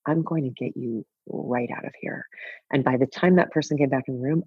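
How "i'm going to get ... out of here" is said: quiet, tender promise to herself; soft reassurance. Retold or imitated. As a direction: softly promise to get her out. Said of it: "I said out loud to her".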